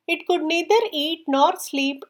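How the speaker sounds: ordinary delivery, nothing marked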